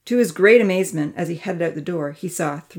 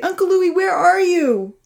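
high-pitched, urgent shout; loud calling out; 13 semitones higher, 6 dB louder than usual. A narrator's reading. Amy searches for Uncle Louie, calling loudly. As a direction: yell for a grown-up who is missing. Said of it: "she called out loudly".

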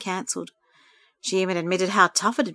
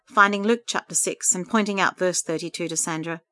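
ordinary delivery, nothing marked

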